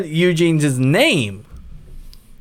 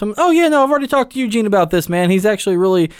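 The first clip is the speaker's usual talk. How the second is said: breezy host voice; 7 semitones higher, faster than usual. Retold or imitated. Voicing the host reassuring them.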